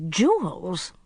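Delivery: a mild, understated gasp of surprise; startled at the sight, lightly played. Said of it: "she gasped".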